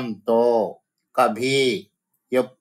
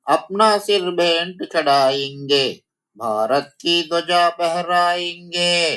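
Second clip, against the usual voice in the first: raised, sing-song recital of the line; poem recitation; 6 semitones higher than usual. Shown as a demonstration. Teacher reciting the poem line to her students, sing-song. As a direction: chant the verse to the class.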